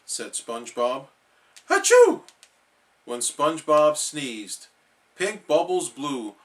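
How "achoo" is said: big loud sneeze. Acted. Sick SpongeBob sneezing at Gary. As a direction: burst out with a huge achoo.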